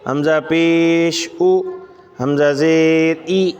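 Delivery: slow drilled chant, each syllable clearly separated; reverse recitation. Shown as a demonstration. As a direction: recite backwards for students to follow. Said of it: "उल्टा भी पढ़ सकते हैं".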